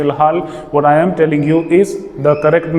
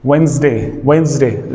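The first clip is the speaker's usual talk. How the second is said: ordinary talk, nothing marked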